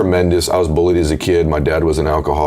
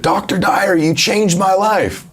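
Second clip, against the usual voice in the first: much higher, slow, gushing fan; starstruck gush; slower than usual. Retold or imitated. Young runner gushing to his hero on the beach, high starstruck. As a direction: pour it out like an overwhelmed fan.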